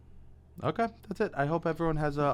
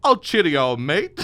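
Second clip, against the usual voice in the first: put-on higher, louder English accent, laughing; mock English accent; 4 semitones higher, 7 dB louder, slower than usual. Mocking English accent, joking about a fat suit. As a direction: ham up a posh English "cheerio". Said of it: "that was an English accent".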